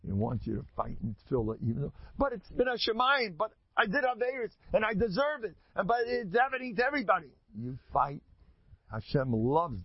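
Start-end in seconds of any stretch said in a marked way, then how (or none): 2.2-7.3 high-pitched mock objection; hammed-up protest, pitched well up; protesting excuses. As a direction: plead the excuses in a raised voice. Voices objections to fighting in prayer.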